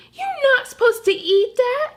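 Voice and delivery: high, pleading puppy voice; upset puppy. Acted. Frosty pleading with the Martian eating the couch, high and upset.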